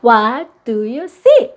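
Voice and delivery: put-on drawn-out, very slow picture-book question to a child; slow read-aloud question. Shown as a demonstration. Parent reading to a toddler, slow and drawn out. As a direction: stretch each word for the little ones. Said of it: "책 읽어주면서".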